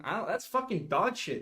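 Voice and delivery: normal talking voice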